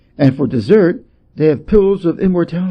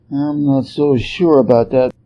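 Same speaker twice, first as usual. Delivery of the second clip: ordinary delivery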